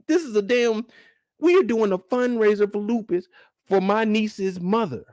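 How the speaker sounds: normal talking voice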